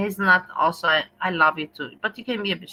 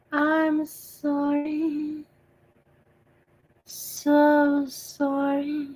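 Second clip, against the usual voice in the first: high, very slow eerie wail; spooky ghost voice; 8 semitones higher, much slower than usual. Pretend. Streamer spooking chat with a slow ghostly wail. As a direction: drag each word out like a haunting ghost. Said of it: "now I'm being silly".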